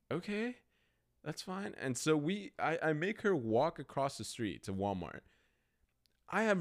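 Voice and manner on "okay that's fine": lightly imitated girlfriend, higher pitch; a hint of an unsure, high girlfriend voice; hesitant girlfriend. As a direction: raise the pitch, agree doubtfully. Girlfriend's doubtful reply, retold.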